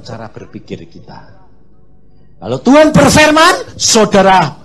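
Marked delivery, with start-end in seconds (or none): 2.4-4.6 high, loud proclamation; loud preaching. Real, not acted. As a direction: declare it with force. Preaching at full force. (khotbah lantang)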